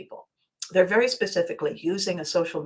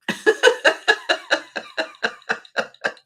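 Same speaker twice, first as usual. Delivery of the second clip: high, loud burst of real laughter; big laugh; 3 dB louder than usual. Real, not acted. Hearing the postal clerk was born in 2000, bursting into laughter. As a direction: break into helpless laughter. Cracking up at his answer.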